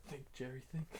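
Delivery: urgent whisper to yourself; hushed, pushing yourself to think. Acted. Urging himself under his breath.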